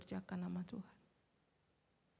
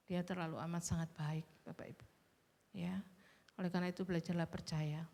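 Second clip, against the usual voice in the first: ordinary talk, nothing marked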